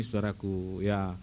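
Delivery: ordinary delivery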